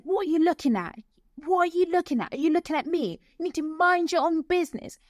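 hammed-up angry stranger yelling; retold shouting. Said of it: "like, shouting".

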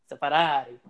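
"Ferrari" with tapped r's and a stretched last syllable; emphasized local Ferrari. Shown as a demonstration. Showing how people down here emphasize, drawled Ferrari. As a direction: lean on "Ferrari" and draw out its ending. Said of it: "they gotta emphasize".

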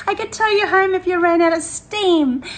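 high, loud laughing retelling; laughing quote. Retold or imitated. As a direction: she repeats her son's cheeky tow offer, laughing high and loud. Quoting son while laughing.

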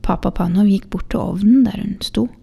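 ordinary delivery

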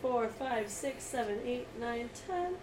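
quiet steady counting. Real, not acted. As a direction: softly count up the messages. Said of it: "twenty-five text messages".

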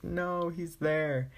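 hammed-up lament at someone's arrival; mock dismay. Retold dismay at Roy coming in.

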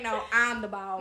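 imitating a boy's country accent. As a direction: mimic a little boss with a country twang. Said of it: "walk around and talk in a country accent".

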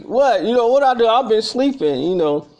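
high, loud protesting what; indignant surprise. Retold or imitated. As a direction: protest your innocence, startled and offended. Jay shocked at accusation.

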